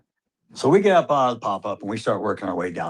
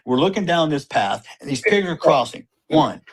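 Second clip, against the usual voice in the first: ordinary talk, nothing marked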